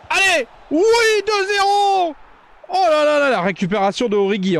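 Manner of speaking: high, loud, triumphant yell; goal celebration shout. Real, not acted. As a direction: roar the second goal.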